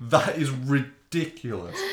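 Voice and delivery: high, loud, drawn-out amazement; retold astonishment. Host retelling reaction to the actress's accents, astonished. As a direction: stretch it out in disbelief.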